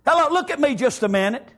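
ordinary talk, nothing marked

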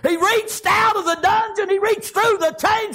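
very high, shouted testimony; full-force preaching. Real, not acted. Preacher telling of God reaching Paul, full-force shouted preaching. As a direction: shout the victory out, high and driving.

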